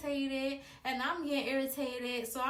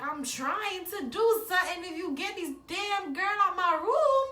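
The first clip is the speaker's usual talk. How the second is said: high, loud, exasperated shout building louder; retold angry yell; 8 semitones higher, 9 dB louder than usual. Boyfriend wanting the sister out of his room, mock furious yell. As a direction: imitate an exasperated boyfriend, climaxing on the last words. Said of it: "He was so mad".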